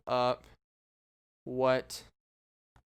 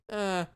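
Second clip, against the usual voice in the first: higher-pitched droning mummy "uh"; mummy groan imitation; 5 semitones higher than usual. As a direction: toneless mechanical mummy bleat. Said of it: "that mummy".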